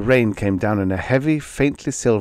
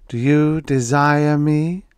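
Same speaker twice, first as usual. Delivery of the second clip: slow, high, intimate plea; breathy seductive whisper; 6 semitones higher, 4 dB louder, slower than usual. A narrator's reading. Woman tempting Harry in the van, slow and seductive. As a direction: lean in and tempt him, slowly. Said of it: "her voice whispered in my ear".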